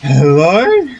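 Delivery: loud, rising call "Hello?". Real, not acted. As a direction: call across to someone far off. Calling out to game character.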